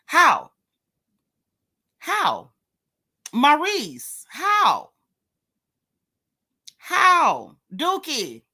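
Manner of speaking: high-pitched, demanding disbelief; incredulous challenge. Real, not acted. Confronting Maurice with repeated demanding questions.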